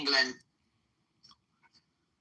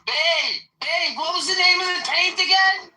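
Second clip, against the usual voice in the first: a much higher, louder call to the other room; 11 semitones higher, 4 dB louder than usual. Real, not acted. Streamer asks babe in the other room for the paint name, calling out. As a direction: call out so babe can hear you.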